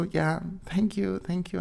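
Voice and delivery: soft, slow, bashful thank you; shy thanks. Retold or imitated. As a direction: murmur it, flustered by the crush. Shy reply to Brandon. (schüchtern, leise und langsam gedankt)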